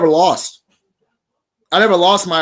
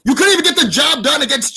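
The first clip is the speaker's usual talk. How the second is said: raised, higher shout with a cry in it; heated yell; 6 semitones higher, 4 dB louder than usual. Real, not acted. Rival wrestler yelling at former partner about title shot, angry. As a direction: hit him hard with it.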